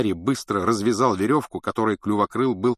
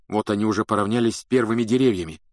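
plain reading voice

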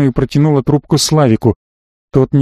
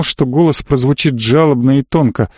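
usual speaking voice

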